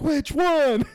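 very high, loud mock yell; exasperated shout. Dad giving in over the night lights, exasperated shout. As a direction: yell it in comic surrender. Retold surrender, shouting at child.

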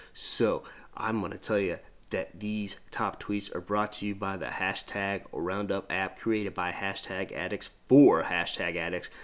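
ordinary delivery

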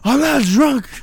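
mock yell. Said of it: "She's fucking yelling already".

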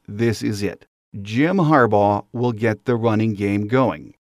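normal talking voice

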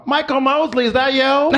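excited greeting voice. Retold or imitated. Retold stranger's greeting.

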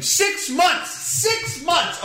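high, exclaimed disbelief; incredulous. Real, not acted. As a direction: repeat it like you can't believe it. Ranting in disbelief at article.

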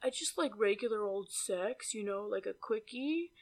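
very soft, understated impression; hushed mock date voice; imitated mumbling. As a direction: imitate him saying it under his breath. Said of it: "that's how he was talking".

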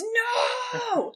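long, high-pitched theatrical wail; mock scream. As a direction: imitate a scream, drawn out and high. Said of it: "He screams".

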